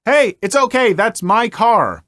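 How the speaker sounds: loud, high call across the lot, slowing at the end; retold friendly call from a distance. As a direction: call over to the stranger, reassuring. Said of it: "I called out".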